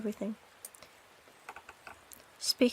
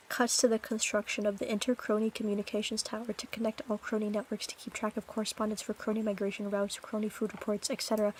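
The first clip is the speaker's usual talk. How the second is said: soft, fast narrator aside; mock documentary narration; 3 semitones lower, 5 dB quieter, much faster than usual. Streamer jokes about crony towers, hushed narrator voice. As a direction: read it like a nature documentary.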